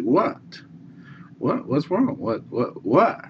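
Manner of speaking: put-on high, anxious repeated whats from a kid; a worried child begging to know; boy's alarmed questions. Little boy asks the old man what's wrong, high and anxious.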